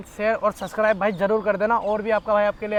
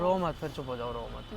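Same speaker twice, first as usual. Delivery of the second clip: soothing; 9 semitones lower, 9 dB quieter than usual. Acted. Boyfriend soothing crying girlfriend.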